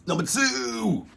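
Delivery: very high, held falsetto call; drawn-out high showman's call; countdown announcer. Real, not acted. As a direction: hoot the number out long and high. Calling out the countdown number.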